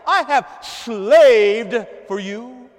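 loud, slow, high drawn-out grievance; bitter complaint. Acted. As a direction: lean hard into the resentment. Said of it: "It's the word for slave".

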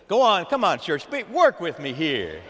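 normal talking voice

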